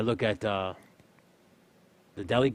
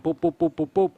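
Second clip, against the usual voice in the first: scanner beeps; 7 semitones higher, 6 dB louder than usual. Retold or imitated. Imitating scanning items fast.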